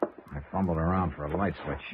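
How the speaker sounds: measured storyteller narration over the music; radio drama narration. A narrator's reading. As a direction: tell it to the audience like a hard-boiled detective. First-person narration over music.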